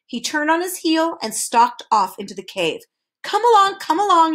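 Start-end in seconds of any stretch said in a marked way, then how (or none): 3.2-4.3 raised, higher-pitched call. A narrator's reading. Hurrying visitors into the cave, calling out. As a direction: call them along with some urgency. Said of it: "he shouted".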